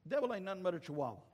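normal talking voice

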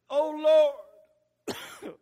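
loud prayer cry that breaks off; real voice failure mid-shout; throat giving out. Real, not acted. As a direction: call out big until the throat gives out. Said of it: "my throat gave out".

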